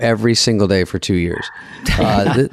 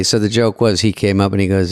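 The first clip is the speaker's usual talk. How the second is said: normal talking voice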